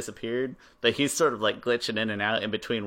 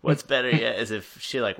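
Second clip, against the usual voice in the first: normal talking voice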